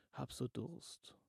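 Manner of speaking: weak, slow, breathless plea; barely able to speak for thirst; faint thirsty whisper. Acted. Fremde, durstige Stimme. (schwach und durstig flüsternd)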